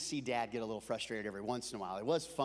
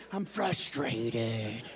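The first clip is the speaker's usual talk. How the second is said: slow, lower, strained correction; imitating frustrated dad; 4 semitones lower, much slower than usual. Dad correcting himself after shouting, slow contained frustration. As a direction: drop down and drag out "frustrated". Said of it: "I'm not mad".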